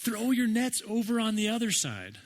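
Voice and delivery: high called instruction; mock call out. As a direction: call it across the water, lightly. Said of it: "And then he yells".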